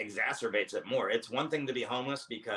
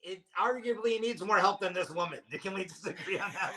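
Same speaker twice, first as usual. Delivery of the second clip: higher, exasperated aside ending in a laugh; mock plea, pitched up and laughing; incredulous inner voice; 9 semitones higher than usual. Comic replays his own thoughts at the scene, high and exasperated. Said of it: "the whole time I was like".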